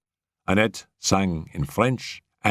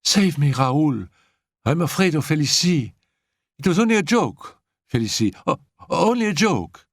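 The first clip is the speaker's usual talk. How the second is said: frightened plea; 9 semitones higher than usual. A narrator's reading. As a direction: beg for protection in panic. Annette terrified, hiding.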